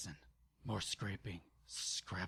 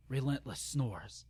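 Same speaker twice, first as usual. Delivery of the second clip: ordinary delivery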